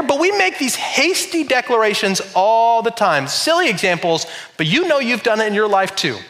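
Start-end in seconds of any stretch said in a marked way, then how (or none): none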